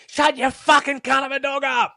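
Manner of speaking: high, strained yell; furious yell at the neighbour over the fence. Retold or imitated. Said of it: "kick the shit out of the Colorbond fence and say".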